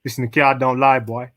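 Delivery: lightly put-on Jamaican accent; a hint of Jamaican patois. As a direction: try a playful Jamaican accent. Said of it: "Say this in your best Jamaican accent".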